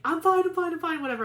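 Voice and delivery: high, loud rush of reassurance, easing down; mock-protest that you're fine, starting high; insistent brush-off. Crying guest waving off her family, insistent brush-off. Retelling brushing off comforting relatives.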